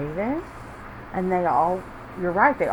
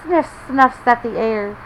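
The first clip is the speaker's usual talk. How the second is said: put-on lively read-aloud to children; story reading; 4 semitones higher, 6 dB louder than usual. A narrator's reading. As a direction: read it like a picture book for little ones. Reading picture book to kids.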